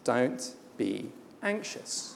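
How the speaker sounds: hushed quote of Jesus; soft, slow command. Acted. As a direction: let the words fall softly and slowly. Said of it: "And Jesus says".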